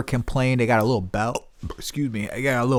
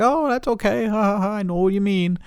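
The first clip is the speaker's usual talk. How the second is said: very high, sing-song phone voice; exaggerated polite little chuckle-along voice; friendly receptionist impression; 10 semitones higher than usual. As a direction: put on a sugary high-pitched receptionist.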